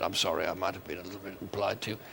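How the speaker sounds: a light Dame Edna imitation, fast and hushed. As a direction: rattle off a sweetly insincere sorry. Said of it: "Edna said".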